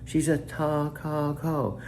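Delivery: patiently drawing it out for a stubborn device; exasperated correcting. Retold or imitated. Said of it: "I say".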